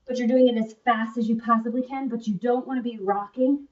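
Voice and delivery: usual speaking voice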